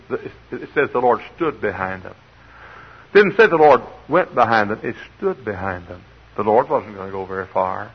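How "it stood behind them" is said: ordinary talk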